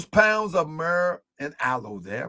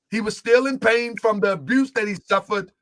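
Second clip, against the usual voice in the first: ordinary delivery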